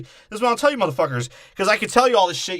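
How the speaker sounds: fast, high, ranting outburst. Real, not acted. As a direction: rattle it off worked up. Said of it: "restart louder faster".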